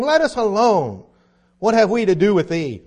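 a reading voice lifted into a loud demon cry. A narrator's reading. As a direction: read it as the spirit crying out. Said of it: "And he cried out".

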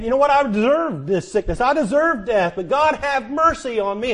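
preacher crying out David's words, mercy on me; impassioned preaching. Acted. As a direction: plead it out loud from the pulpit. Preaching at full force.

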